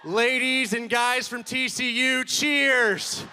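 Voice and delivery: loud, slow call to the crowd; crowd greeting. Real, not acted. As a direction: hype up the audience. Comedian greeting crowd loudly.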